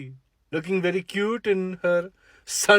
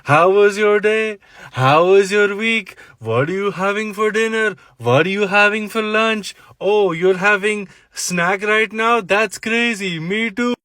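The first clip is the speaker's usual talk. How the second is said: mock small talk; 5 semitones higher than usual. Parodying boring small-talk texts.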